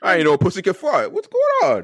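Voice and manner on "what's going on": squeaky disbelief. Retold or imitated. Retold confusion, squeaky.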